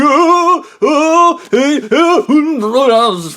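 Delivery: high, loud, panicky yelps; mock panicked screaming. Drowning idiot yells for help, mock panicked. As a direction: cry out like a man being dragged under. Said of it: "screaming as the chain's falling".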